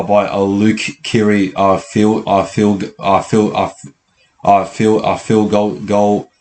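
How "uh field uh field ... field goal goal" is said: stammering, restarting on field and goal; stuck repeating words, with uh between tries; real stuttering. Real, not acted. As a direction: stumble over the words and keep restarting. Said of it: "my stuttering".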